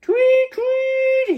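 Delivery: very high, loud whistle imitation; two shrill whistle tweets; whistle blast. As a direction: blow it like a lifeguard's whistle. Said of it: "he heard the loud whistle again".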